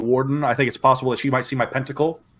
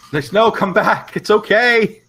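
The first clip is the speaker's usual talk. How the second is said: raised, higher voice calling her back; calling after someone; 9 semitones higher, 5 dB louder, slower than usual. Acted. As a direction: call out urgently to someone running away. Player calls after fleeing girl.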